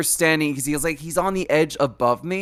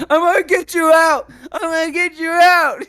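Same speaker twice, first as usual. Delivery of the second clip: much higher, louder cartoon dog yell; eager dog shouting; 6 dB louder than usual. Cooper the dog pulling Tommy from the bush, high eager yell. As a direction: strain and shout like a dog tugging his buddy free.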